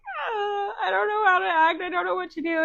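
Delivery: high, mock-tearful little girl whine; imitating crying daughter. Mom imitating her crying daughter, high tearful whine. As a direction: whimper it like an overwhelmed child. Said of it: "She was like".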